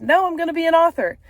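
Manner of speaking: retold bold declaration of her dream; defiant retort. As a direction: fire back with determination. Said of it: "And I said".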